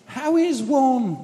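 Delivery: very high, slow, plummy mock aristocrat; posh voice. Mimicking the posh man chatting to the prisoners. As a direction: stretch it out like a caricature toff. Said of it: "going on like that".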